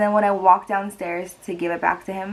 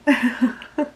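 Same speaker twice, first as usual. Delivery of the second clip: tearful laugh; 6 semitones higher than usual. Real, not acted. Embarrassed laugh through tears.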